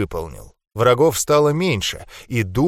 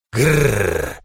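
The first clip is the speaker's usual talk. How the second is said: growl. A narrator's reading. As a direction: growl like a beast. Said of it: "прорычал я".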